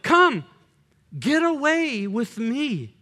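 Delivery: slow, tender call to get away; intimate invitation. Shown as a demonstration. Pastor voicing God's call to the congregation, slow and tender. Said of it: "Do you hear the intimacy in that?".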